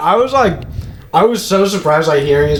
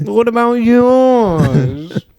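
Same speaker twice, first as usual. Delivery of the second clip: mock villain line; 9 semitones higher, much slower than usual. Joking Star Wars line.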